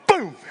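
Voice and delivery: sudden high-pitched "boom"; explosive boom. Retold or imitated. Son grabs the electric fence, explosive boom. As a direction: burst out with a mock shock noise. Imitates the shock hitting his son.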